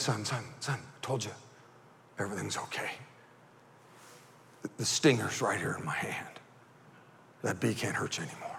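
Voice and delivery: very soft, low, near-whispered comfort; reassuring dad. Retold or imitated. Dad showing his son the bee's stinger, hushed reassurance. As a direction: hush the child, low and soft.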